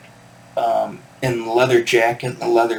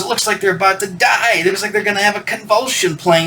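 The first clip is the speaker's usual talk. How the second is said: 1 crazy fan; 8 semitones higher than usual. Retold or imitated. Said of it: "And he goes".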